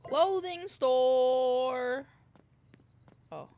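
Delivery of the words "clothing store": slow loud drawn-out announcement; announcer voice. Pretend. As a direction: stretch it out like a grand announcer.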